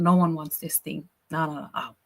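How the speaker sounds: ordinary talk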